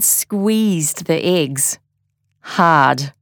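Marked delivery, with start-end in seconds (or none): none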